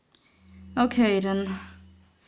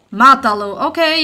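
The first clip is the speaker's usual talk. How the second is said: ordinary talk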